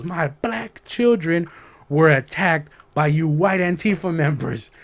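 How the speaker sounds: imitated accusation, slow, high and loud; emphatic. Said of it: "she made it clear".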